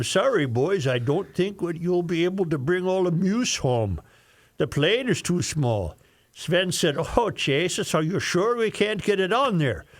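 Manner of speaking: lightly voiced Norwegian pilot; Norwegian accent. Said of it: "use your worst Norwegian voice".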